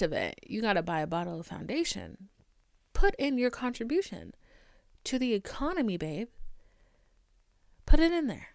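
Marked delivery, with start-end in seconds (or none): 2.9-8.5 high, loud teasing order; sarcastic loud demand. Pretend. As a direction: bark it like a pushy salesman. Mock demand to buy foundation.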